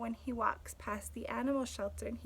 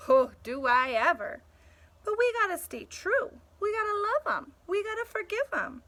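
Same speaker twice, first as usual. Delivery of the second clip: higher, livelier dog voice; dog character voice; 9 semitones higher, 7 dB louder than usual. Acted. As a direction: play the loyal dog pleading his case. Dog voices in read dialogue.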